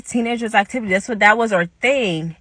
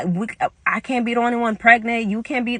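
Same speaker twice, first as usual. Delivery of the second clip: usual speaking voice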